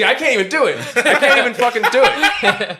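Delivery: high, loud laughing protest. Real, not acted. Laughing at his own failed no-swear impression, high and loud. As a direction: crack up while admitting defeat.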